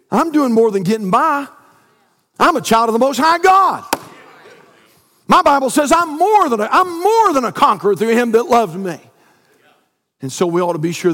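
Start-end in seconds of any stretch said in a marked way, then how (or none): 0.1-9.0 rising pulpit proclamation; preaching. Acted. Pastor proclaiming victory to his church, rising preaching. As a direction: declare it boldly.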